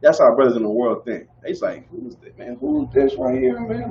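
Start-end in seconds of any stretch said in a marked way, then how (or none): 1.9-3.9 suspicious. Retold or imitated. Suspicious brothers in the world.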